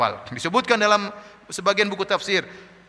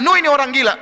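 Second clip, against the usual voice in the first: loud, high-pitched jeer; mocking; 8 semitones higher, 6 dB louder than usual. The people deride Noah as insane, mocking. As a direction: fling it out as a loud taunt. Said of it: "Diejek terus".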